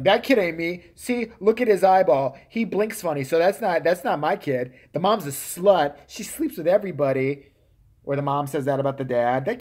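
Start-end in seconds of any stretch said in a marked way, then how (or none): 0.0-7.5 loud, hammed-up accusing shout; mock angry guest. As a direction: yell it like a guest disowning the kid. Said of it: "He's like".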